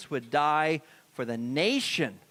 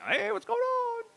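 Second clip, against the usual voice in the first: sudden much higher, squeaky distracted call; distracted shiny-thing voice; slower than usual. Pastor acting out shiny thing syndrome, sudden squeaky distraction. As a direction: pop up like something shiny caught your eye. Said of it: "Shiny thing syndrome".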